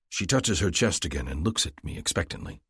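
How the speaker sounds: plain reading voice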